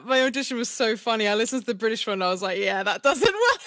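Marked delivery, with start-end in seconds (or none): 2.6-3.6 higher, louder, slowed retold reaction; amused self-verdict. Actress mocking her own British audition, amused dismay.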